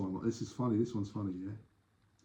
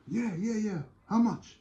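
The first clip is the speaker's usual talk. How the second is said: high, eager tourist voice; acted tourist; 10 semitones higher, slower than usual. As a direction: play the keen visitor wanting to buy. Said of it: "He's a tourist".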